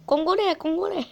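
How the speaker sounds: high, put-on mock African accent. Player choosing between accents, trying a mock African one. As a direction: pitch it up in a caricatured African accent. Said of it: "avec un accent africain".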